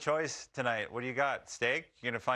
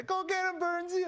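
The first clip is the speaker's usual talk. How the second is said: imitated yelling. Jumbo urging Burnsy on at the forecheck, high imitated yell. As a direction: egg a teammate on, pitched way up. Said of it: "him just yelling".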